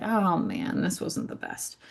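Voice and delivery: soft, mild lament fading away; understated, voice dropping to almost nothing. Acted. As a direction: sigh it out in quiet lament. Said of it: "sometimes you're lamenting".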